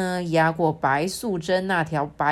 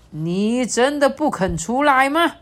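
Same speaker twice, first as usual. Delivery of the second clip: stern; 6 semitones higher, 6 dB louder than usual. Acted. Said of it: "很严厉地说".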